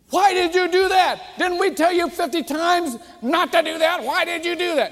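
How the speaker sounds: high, loud scolding parent; acted parental rant at full volume; angry tirade. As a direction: erupt at the child in exasperation. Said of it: "let me interrupt my own tirade".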